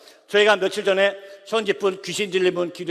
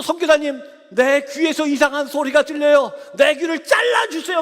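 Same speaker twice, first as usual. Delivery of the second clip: frantic plea; 6 semitones higher, 5 dB louder than usual. Retold or imitated. Possessed youth begs, ends yelling.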